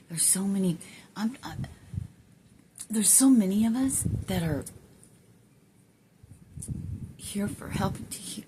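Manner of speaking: welling up, halting between phrases; getting emotional. Real, not acted. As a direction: voice rising high with real emotion. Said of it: "I don't know why I'm getting so emotional".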